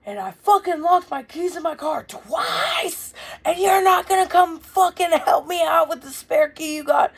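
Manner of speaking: high furious shout, slowed; screaming at mom. Retold or imitated. Angry son screaming at mom over the payphone, high shout. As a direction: yell the word locked hard and high. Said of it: "So I'm screaming at her on the phone".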